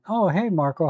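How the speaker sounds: usual speaking voice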